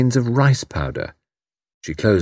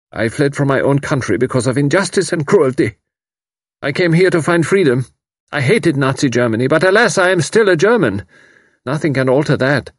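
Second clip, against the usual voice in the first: put-on young German man in torment; anguished German refugee; 6 semitones higher than usual. A narrator's reading. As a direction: pour out his torn loyalty. Karl pours out his anguish.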